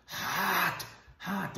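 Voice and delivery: high, strained imitation of terror; sheer terror. Father wakes beside his daughter, imitated in sheer terror. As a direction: imitate a terrified man managing one word. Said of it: "he's in sheer terror".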